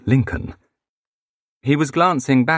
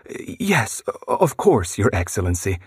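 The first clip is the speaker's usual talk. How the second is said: hint of a fretting hostess, rising on yes; flustered lady. A narrator's reading. Flustered hostess answers president.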